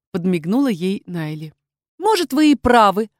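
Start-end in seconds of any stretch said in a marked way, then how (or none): 2.0-3.1 brisk, upbeat reply, higher and louder; cheerful. A narrator's reading. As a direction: say it with lively energy. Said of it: "бодро ответила Лейла".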